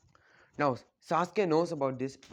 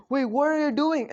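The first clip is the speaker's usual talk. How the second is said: high, loud panicked yelp; scared cry; 11 semitones higher, 7 dB louder than usual. A narrator's reading. Sasuke seeing Naruto rip off his arm, panicked high cry. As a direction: cry out in alarm, pitched way up. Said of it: "completely scared".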